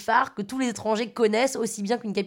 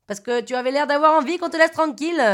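higher, louder Marseille man; pitched-up southern French, Marseille style; imitated Marseille accent; 5 semitones higher, 5 dB louder than usual. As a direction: put on a loud Marseille accent.

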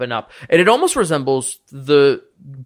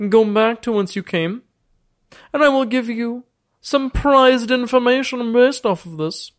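sly bargaining lord; 9 semitones higher than usual. Acted. Lord warns the party and offers a trade, grand and much higher. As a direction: weigh each word like a crafty old lord.

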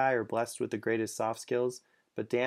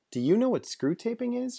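surprised, much higher question; 12 semitones higher, 4 dB louder than usual. Acted. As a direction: ask it in disbelief.

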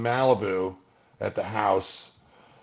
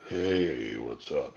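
much lower and softer, a put-on sinister greeting; mock dark guy; 10 semitones lower, 5 dB quieter, much slower than usual. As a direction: sound like a brooding creep saying hi. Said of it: "a darkness like".

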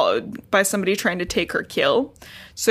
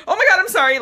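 high, louder imitation of apologizing; mock panicked apology; 11 semitones higher, 5 dB louder, much faster than usual. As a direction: blurt a hammed-up sorry in a high voice. Voices a hurried retold apology.

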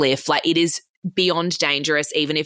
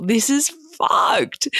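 stunned outrage; 12 semitones higher, slower than usual. Retold or imitated. Her shocked reaction to being ghosted.

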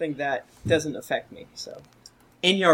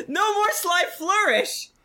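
much higher, theatrical cry; hammed-up exclamation; 11 semitones higher than usual. Host reading out a listener's review, hammed-up protest. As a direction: cry it out high, playing it up.

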